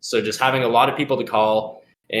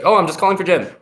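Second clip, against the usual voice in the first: high, louder, offhand reply; quick, friendly familiarity; 9 semitones higher, 5 dB louder, faster than usual. Shown as a demonstration. Caller answering the gatekeeper, quick and familiar like a friend. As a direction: brush it off like you're his friend. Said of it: "You say it really quick, like you know Jim, and, like, he's your friend".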